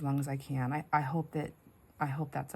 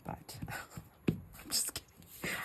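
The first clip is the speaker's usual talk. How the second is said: softer conspiratorial whisper; real whisper; 9 dB quieter, much slower than usual. Real, not acted. Joking to viewers about smacking her husband, whispering. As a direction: hush it like a guilty secret. Confessing a joke under her breath.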